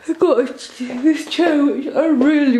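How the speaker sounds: words stretched by a yawn; talking through a yawn. Real, not acted. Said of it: "sorry I'm yawning so much".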